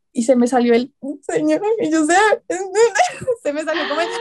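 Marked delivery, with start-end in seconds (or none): 2.2-3.4 high, crying-laugh burst; a sob that breaks into a laugh. Real, not acted. Retelling crying on the call, laughing sob. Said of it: "se dio cuenta que yo estaba llorando".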